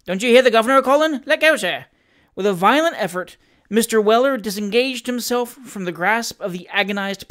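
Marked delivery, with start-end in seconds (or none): 0.0-1.9 louder, higher-pitched urgent protest; impatient servant. A narrator's reading. Servant to the skater clinging to him, impatient and urgent. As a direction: urge him off, raised voice.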